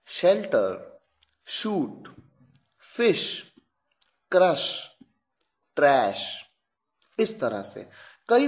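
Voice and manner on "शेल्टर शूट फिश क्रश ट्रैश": फिश and ट्रैश ending on a clear sh; sh examples. Shown as a demonstration. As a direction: model each word with sh. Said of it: "देखिए".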